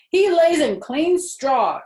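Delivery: slow, loud, firm correction; correcting. A narrator's reading. Husband correcting his wife, slow and firm. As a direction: set her straight, slow and loud. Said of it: "corrected Mister Zuckerman".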